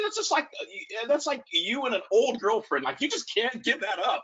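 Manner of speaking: high mock-scolding teasing voice; playful roast. Coach roasting a player at practice, high mock teasing. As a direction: tease the player in front of the team. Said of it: "I'm just roasting this kid".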